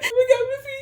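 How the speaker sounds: high, loud sobbing words; crying. Retold or imitated. Telling her friend about the breakup, loud high sobbing.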